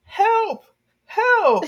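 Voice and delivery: high, loud mock cry for help. Girl hauled down the street by the neck, mock cry for help. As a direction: yelp it out like a dragged-off kid.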